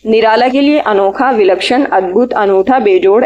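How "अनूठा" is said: ordinary talk, nothing marked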